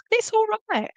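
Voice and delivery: high tender reassurance from mum; high comforting voice. Retold or imitated. Medium voicing her mother's comfort.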